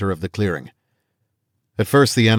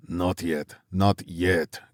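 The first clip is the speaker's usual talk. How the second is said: South African growl; 6 dB quieter, much slower than usual. A narrator's reading. South African hunter restraining the others, softer accented growl. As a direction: hold them back in a thick South African accent. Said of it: "growled the South African in thickly accented English".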